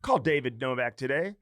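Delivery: ad read. Acted. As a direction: spell out the sponsor like a radio ad. Host reads sponsor ads.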